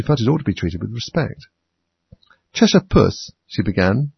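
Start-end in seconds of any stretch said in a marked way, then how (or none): 2.5-3.3 high, tentative call; mildly timid, lifted voice; rather timid. A narrator's reading. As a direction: address it a little timidly. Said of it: "rather timidly".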